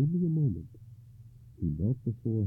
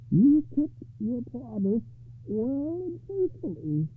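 high, very slow character voice; slow, high-pitched hag lady, musing; thoughtful monstrous bride; 5 dB louder, slower than usual. A narrator's reading. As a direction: draw it out high and deliberate.

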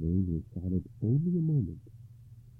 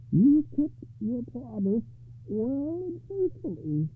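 slow lady voice; 5 dB louder, slower than usual. Acted. Enchanted lady to husband.

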